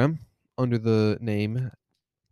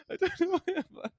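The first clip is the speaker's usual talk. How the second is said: very high, soft, quick confused voice; 7 dB quieter, faster than usual. Pretend. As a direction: play a lost patient through laughter.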